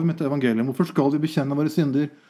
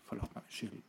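whisper; 5 semitones lower, 22 dB quieter than usual. Retold or imitated. Quoting defeated prayer.

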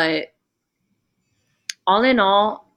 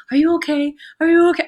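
high, louder imitation of a distraught mother; crying mom; 8 semitones higher, 5 dB louder, faster than usual. Mom crying in her daughter's face after birth, distraught. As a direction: mimic a worried mother's urgent cry. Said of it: "crying in my face".

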